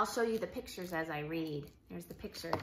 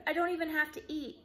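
put-on higher, fast eager child; eager boy rushing; 6 semitones higher, faster than usual. Jake talks fast to the official, eager child voice. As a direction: rush it like an excited kid.

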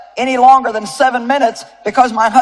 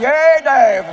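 mock cheer; 4 semitones higher than usual. Shown as a demonstration. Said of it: "Tell him".

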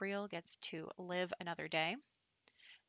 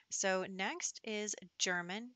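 ordinary delivery

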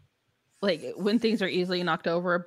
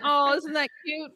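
high-pitched mock cooing; put-on indulgent voice, pitched way up; doting relatives; 8 semitones higher, 5 dB louder than usual. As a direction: imitate relatives fawning over a spoiled cousin. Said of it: "And everyone's like".

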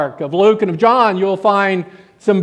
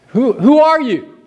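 higher-pitched retold question; mock insistent questioning; 7 semitones higher than usual. Questioners asking John who he is, higher acted demand. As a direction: press it as his questioners did.

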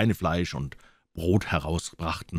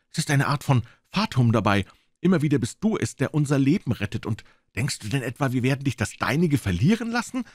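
put-on higher-pitched doctor marvelling at the boy; animated doctor; 6 semitones higher, 4 dB louder than usual. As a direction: reassure the boy with lively conviction.

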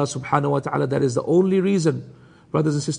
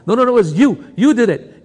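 higher-pitched playful squabble, laughter creeping in; mock blaming; 6 semitones higher, 4 dB louder than usual. Naughty Yusuf arguing back at Ibrahim, mock blaming. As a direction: throw the blame back like a bickering boy. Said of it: "they're gonna blame each other".

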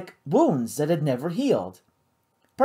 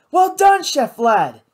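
delighted praise; 9 semitones higher, 5 dB louder, slower than usual. A narrator's reading. Kate praises the chef.

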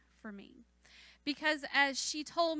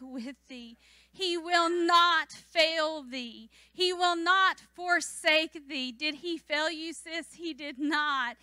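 preaching scripture; 3 semitones higher, 8 dB louder than usual. Acted. Preacher proclaiming God's promise to the church, emphatic preaching. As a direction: thunder the promise.

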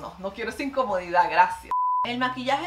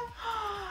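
soft, very high gasp; amazed gasp; 10 semitones higher, 7 dB quieter than usual. Real, not acted. Reacting to curls coming loose, amazed gasp. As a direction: breathe in an awed "oh".

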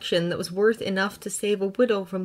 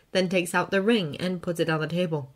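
plain reading voice

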